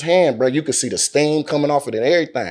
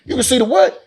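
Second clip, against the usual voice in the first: very high incredulous squeak; retold shocked question; 13 semitones higher, faster than usual. Reacting to the boar sighting, retold shocked question. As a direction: voice shoots up in mock disbelief.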